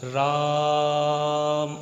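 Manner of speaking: long drawn-out call of a name; राम called with a very long, level आ; calling out to someone. Shown as a demonstration. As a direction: stretch the vowel of राम on one held note, as when hailing someone far off. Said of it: "तो आवाज़ कैसे देगा?".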